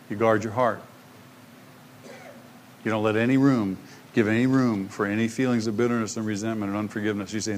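ordinary talk

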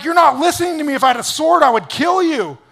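loud, high mock fury; acted angry retort. Balaam quarrelling with his talking donkey, hammed-up anger. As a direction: shout back as an outraged master.

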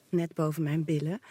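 usual speaking voice

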